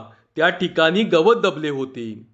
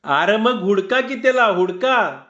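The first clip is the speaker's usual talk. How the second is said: higher, urgent call to search; urging search; 4 semitones higher than usual. Acted. Villager urging everyone to search for the thief, raised urgent call. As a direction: push them to hunt him down.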